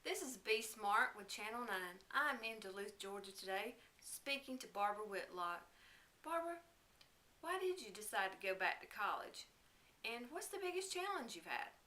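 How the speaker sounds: loud, upbeat field reporter; bright on-location news read; parody news reporter. Acted.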